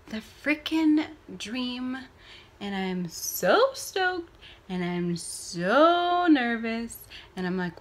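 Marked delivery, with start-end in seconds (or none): 2.6-7.0 slow, stretched nervous excitement; slow giddy confession; drawn-out. Real, not acted. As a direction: linger on "so" each time.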